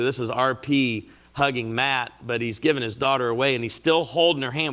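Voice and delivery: usual speaking voice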